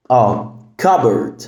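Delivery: normal talking voice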